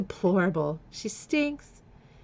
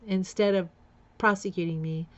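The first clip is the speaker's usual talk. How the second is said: ordinary delivery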